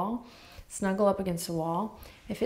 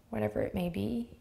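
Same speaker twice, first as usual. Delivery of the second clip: usual speaking voice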